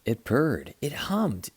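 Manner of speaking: ordinary delivery, nothing marked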